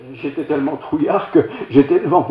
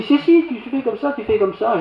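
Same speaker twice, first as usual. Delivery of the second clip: put-on old lady showing how; 10 semitones higher than usual. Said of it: "Et elle dit".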